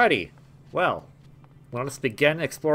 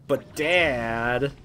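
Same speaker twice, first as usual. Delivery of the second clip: mild Zagreus impression. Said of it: "his son Zagreus is all like".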